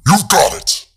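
sudden loud high shout; jump-scare shout. A narrator's reading. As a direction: scream it to make them jump.

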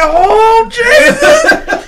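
squealed Oh Jesus, very high and loud; a high, loud, startled squeal; high shocked cry. Retold or imitated. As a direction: shriek it in disbelief, half laughing.

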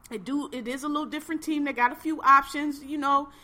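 retold excited belief. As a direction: pump yourself up about the team. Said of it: "I was like".

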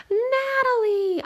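high, long drawn-out cry of joy; excited cry. Acted. Brother thrilled at sister's news, excited cry. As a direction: call her name in delight.

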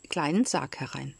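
plain reading voice

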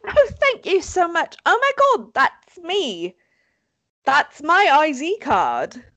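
high-pitched mock-amazed householder; hammed-up grateful surprise; door answerer. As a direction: play the delighted owner of a lost item. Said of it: "I'm gonna be the person who answers the door".

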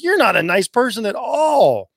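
high, drawn-out mock dismay; appalled realization. Reacting to a comment about a woman, appalled. Acted outrage at a rude comment.

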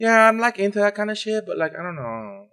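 high-pitched mock girlfriend voice; put-on high voice for a reluctant girlfriend; imitating girlfriend. Voicing girlfriend's reluctant reply.

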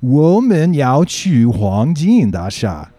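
slow, loud, carefully pronounced Mandarin; "Wo men yao chu Huangjin Da Sha" drawn out slowly and loudly, every syllable given its tone; overly precise Mandarin. Retold or imitated. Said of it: "And the guy goes".

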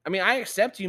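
ordinary talk

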